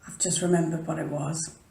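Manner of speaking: ordinary talk, nothing marked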